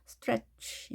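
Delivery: "스트레치" softly, airflow stopped before "취"; stopped "ch" sound. Shown as a demonstration. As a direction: halt the air, then release "취" in "스트레치". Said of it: "일단 멈춤". ("스트레치"를 멈췄다가 "취"로 약하게)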